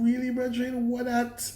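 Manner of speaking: high mock disbelief in Jamaican talk; incredulous retort. Reviewer questioning Mike's performance, high incredulous retort. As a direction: throw it up at Mike like a baffled friend. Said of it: "I'm going".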